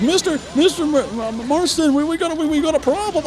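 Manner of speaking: high, stammering ranch hand voice; panicked ranch hand. Acted. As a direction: stutter out the bad news in a fluster. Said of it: "It's like".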